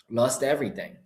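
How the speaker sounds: usual speaking voice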